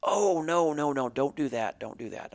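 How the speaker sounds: high, loud no that fades off by the end; alarmed objection. Retold or imitated. Mike hears Charlie wants to ride broncs, alarmed loud protest fading. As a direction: urgently talk him out of it, then settle down.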